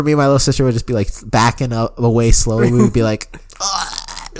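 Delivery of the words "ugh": high, quiet gagging noise; mock gag, about to puke; strangled "ugh" as if holding back vomit; imitated retch. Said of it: "like, about to fucking puke".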